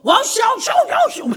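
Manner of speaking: high loud babbling shout; tongues babble. Retold or imitated. Father receiving the Spirit, high loud babble. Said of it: "그 받았고".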